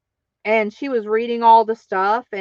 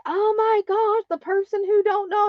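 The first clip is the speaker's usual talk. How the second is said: much higher, sing-song teasing; high mocking gossip; mock taunting; 11 semitones higher than usual. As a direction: tease like a catty classmate. Others mocking the clueless narrator.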